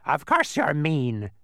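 loud, high, slow bird voice; interrupting bird. A narrator's reading. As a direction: cut in with a drawn-out squawk. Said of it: "interrupted the bird".

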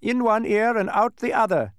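high, loud telling-off; scolding duke. A narrator's reading. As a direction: scold in a high, pinched character voice. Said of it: "scolded the duke".

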